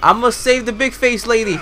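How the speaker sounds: high, loud gung-ho cry; loud heroic boast. Pretend. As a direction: belt it out like a cocky rescuer. Mock heroic declaration in game.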